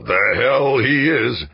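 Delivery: loud, high, slow angry snap; snapped. Acted. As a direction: bite the words off in anger. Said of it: "Garrison snapped".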